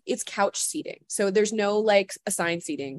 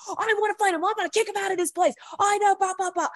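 much higher, hammed-up indignant rant; mock indignant friend; 12 semitones higher, faster than usual. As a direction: rant like a buddy puffing himself up. Director friend outraged.

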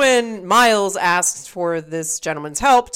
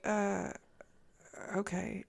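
much softer, trailing "uh, okay"; mock awkward, barely audible; awkward mumble; 16 dB quieter, slower than usual. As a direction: let it die away, unsure what to say. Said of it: "And he was like".

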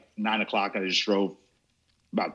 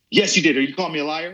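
retold accusation; 6 semitones higher, 4 dB louder, faster than usual. Woman accusing him.

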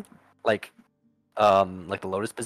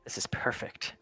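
put-on whispered old lady's delight; gleeful whisper; 3 semitones higher, 11 dB quieter than usual. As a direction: breathe it softly, like relishing a secret. Old lady delights at the kill.

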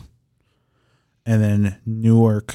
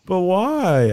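mock whine; 9 semitones higher than usual. Real, not acted. Mock protest over soundboard.

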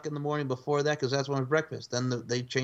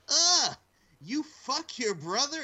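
shocked outburst; 12 semitones higher, much slower than usual. Retold or imitated. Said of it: "and he goes".

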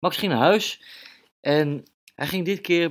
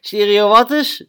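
high, loud baffled question; puzzled little mouse; 10 semitones higher, 10 dB louder than usual. Acted. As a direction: blurt it out, confused by the big word. Max puzzled by a hard word.

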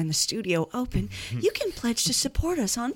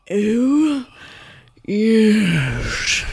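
voice effect; 8 dB louder than usual. Pretend. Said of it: "Did you put an effect on my voice".

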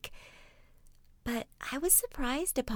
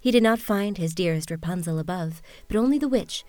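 storybook narration; 2 semitones lower, 9 dB louder than usual. A narrator's reading. Reads fairy tale aloud.

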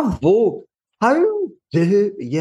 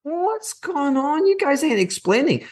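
mocking the natives; 11 semitones higher than usual. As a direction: drag out "What's going on?" like a puzzled local. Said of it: "then the natives go".

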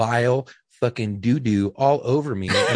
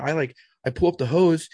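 normal talking voice

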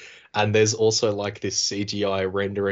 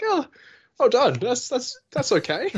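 sarcastic well done, pitched way up; sarcastic praise; 13 semitones higher than usual. Retold or imitated. Viewer mocking a dying character's line, high sarcastic praise. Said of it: "And I was like".

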